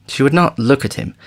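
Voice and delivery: ordinary delivery, nothing marked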